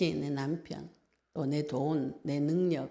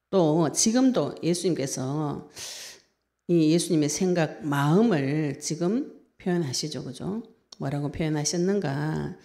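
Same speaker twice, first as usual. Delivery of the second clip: louder, forceful preaching voice; 5 dB louder than usual. Acted. Preacher proclaiming Jesus' compassion to the congregation, forceful.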